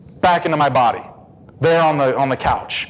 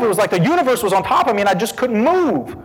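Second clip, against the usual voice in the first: normal talking voice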